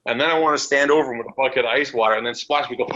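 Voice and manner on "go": ordinary talk, nothing marked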